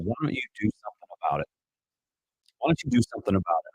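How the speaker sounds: quick, hushed voice of God from within; a light imitation of God nudging him; God's voice. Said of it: "I believe possibly God is saying".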